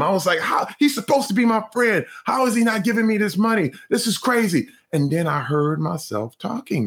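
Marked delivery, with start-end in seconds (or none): 0.4-4.7 mock angry rant. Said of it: "I was angry and I was mad at him".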